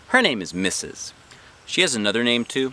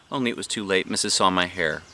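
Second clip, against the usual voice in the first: ordinary delivery, nothing marked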